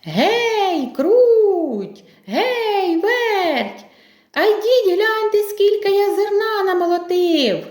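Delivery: high, singsong rooster's call. Acted. Rooster calls mice to see the grain, calling out. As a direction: call far across the yard, high and drawn out. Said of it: "та й знов гукає".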